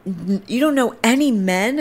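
high, disbelieving question; high-pitched mock disbelief; incredulous woman imitation. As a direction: mimic her astonishment, ending on a rise. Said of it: "And she goes".

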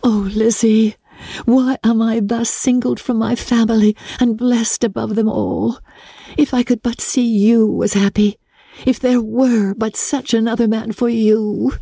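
lightly voiced Jane. Jane confiding in her sister, lightly voiced young lady. Jane's voice continues.

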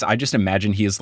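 normal talking voice

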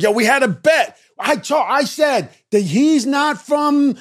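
high loud rapid bragging; excited shout. Retold or imitated. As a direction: boast about calling it right. We had a bet.

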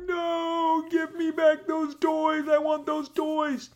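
put-on very high, slow whiny kid voice; hammed-up toddler tantrum voice; mock childish whine. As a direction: whine like a child grabbing back toys. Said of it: "I'm like".

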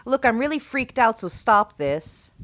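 loud, high-pitched agitated demand, slowing at the end; freaked-out yell. A narrator's reading. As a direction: shout it, freaked out, at someone hiding. Freaked-out plea shouted into empty house.

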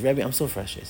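quiet, downcast complaint; frustrated student. Retold or imitated. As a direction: imitate a discouraged student. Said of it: "Why are you frustrated?".

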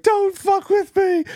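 very high, slow, whiny mock wail; high, drawn-out, pathetic mock cry; mock weak crying. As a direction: whimper it like a weak crybaby. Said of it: "sounds like he's crying".

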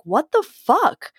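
high, slow, outraged "what the fuck". Retold or imitated. Retelling her outrage at him, high and slow. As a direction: stretch it out in high disbelief. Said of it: "I was like".

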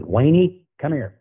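imitating a coach beckoning, pitched high; calling someone over.

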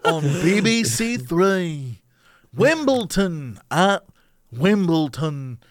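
slow British announcer drawl. Retold or imitated. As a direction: draw out the vowels like a BBC announcer. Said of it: "draw out your O sounds".

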